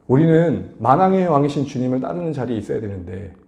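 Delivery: ordinary talk, nothing marked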